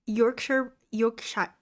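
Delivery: "Yorkshire" with a rolled American R, yor-kshurr. Shown as a demonstration. Learner saying the place name, American R sounded. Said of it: "pronouncing the R like an American".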